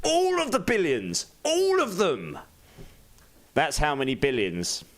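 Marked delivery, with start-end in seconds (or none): 0.0-2.4 high, booming joke answer; grand mock declaration. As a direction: proclaim it like a villain. Joking answer, grand mock reply.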